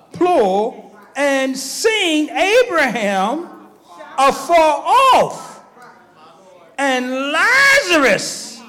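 very slow, drawn-out preacher's reading; solemn scripture reading. Acted. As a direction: lay each phrase down slowly, like holy writ. Reads scripture slowly, solemnly.

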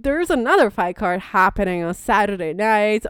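ordinary talk, nothing marked

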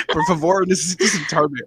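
imitating how the man asked for a seat; whiny seat beggar. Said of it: "Empiece a decir".

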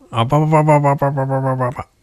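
fast, high bubbling babble; underwater babbling. Acted. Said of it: "水に潜って声を出しているような".

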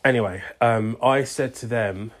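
usual speaking voice

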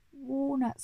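high-pitched monkey exclamation; excited mock scream. A narrator's reading. Monkey hears of walnut trees, mock scream of excitement. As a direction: squeal it in delighted disbelief, without full volume. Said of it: "screamed the monkey".

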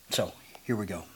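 breathy whisper to the congregation. Real, not acted. Pastor whispering to congregation before the sermon, whispered. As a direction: hush it out, like a secret start. Preacher launching in.

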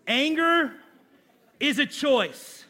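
Anger shouted high, then is a choice slowed with a laugh; preacher repeats anger is a choice, loud and laughing; emphatic repeat. Shown as a demonstration. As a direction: hammer the point home, laughing. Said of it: "punchline repeated".

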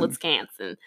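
put-on low, teasing Wisconsin accent on "Wisconsin"; mock Wisconsin accent. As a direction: imitate a Wisconsin native saying "Wisconsin". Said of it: "Amber makes fun of your accents".

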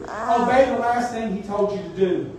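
usual speaking voice

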